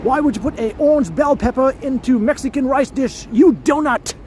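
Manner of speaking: high, exasperated British chef voice; hammed-up scolding of a hapless cook; angry Gordon Ramsay. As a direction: berate them like an irate British chef. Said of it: "before I make this attempt here".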